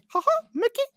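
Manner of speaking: very high squeaky cartoon mouse; Mickey. Host runs through his voices, high cartoon mouse impression. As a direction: give a high-pitched cartoon chuckle. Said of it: "There's Mickey".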